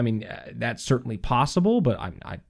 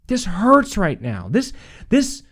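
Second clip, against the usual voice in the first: raised, loud, pained complaint to God; mock angry prayer; 8 semitones higher, 6 dB louder, slower than usual. Preacher voicing an angry prayer to God, loud and pained. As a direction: pour out frustration, pitched up and loud.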